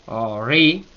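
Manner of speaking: "Ori" stretched, rising on the i; accented final i. Shown as a demonstration. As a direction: lift and lean on the last vowel of "Ori". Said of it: "lleva acento".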